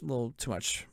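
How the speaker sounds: quiet aside. Real, not acted. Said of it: "I drank a little too much".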